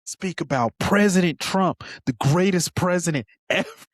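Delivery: mocking Bondi defending Trump loudly; mock outraged defense. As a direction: ham up the loyal outburst. Mocking Bondi's hearing outburst.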